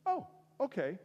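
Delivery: high, chirpy acceptance; Pastor Dave. Retold or imitated. Pastor turned down and getting up to leave, high and chirpy.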